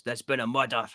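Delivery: a deliberately bad, lightly put-on Scottish accent; mild mock Scottish accent. Shown as a demonstration. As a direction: ham up a poor Scottish detective line. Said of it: "the worst Scottish accents".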